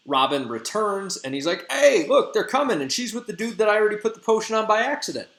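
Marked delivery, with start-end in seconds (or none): 1.7-5.3 high, quick, loud heads-up; pitched-up, rushed announcement; excited alert. Retold or imitated. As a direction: blurt out the news as an acted messenger. Robin returns with news, acted.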